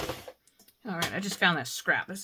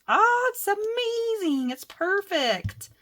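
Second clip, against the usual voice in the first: much higher, slow, drawn-out gush; gushing delight; 13 semitones higher, 7 dB louder, much slower than usual. Real, not acted. Crafter gushing over the perfect leaves, slow and high. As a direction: stretch it out in awe.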